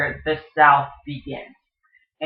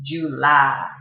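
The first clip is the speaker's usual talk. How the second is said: Virginia drawl; 5 dB louder than usual. Shown as a demonstration. Teacher shows how Virginians draw out July. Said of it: "They will kind of draw out into".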